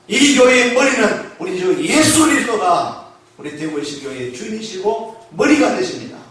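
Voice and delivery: ordinary talk, nothing marked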